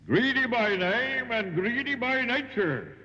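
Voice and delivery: high, slow, sustained bellow; giant's bellow. A narrator's reading. Giant mocks his greedy captive, bellowing. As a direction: bellow each word out long. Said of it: "he bellowed".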